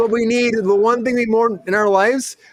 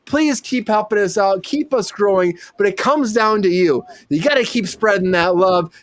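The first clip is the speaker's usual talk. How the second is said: normal talking voice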